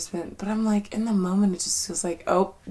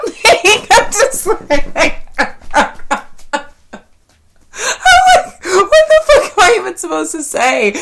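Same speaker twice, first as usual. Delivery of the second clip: high, loud laughing question; cracking up while asking; laughing helplessly, higher and louder; 12 semitones higher, 13 dB louder than usual. Real, not acted. Laughing at her own awkwardness.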